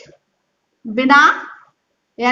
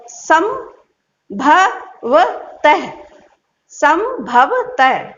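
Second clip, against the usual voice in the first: संभवतः drawn out syllable by syllable; slow syllable reading; much slower than usual. Shown as a demonstration. Teacher slowly reading संभवतः aloud. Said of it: "इसको देखिए आप कैसे पढ़ना है".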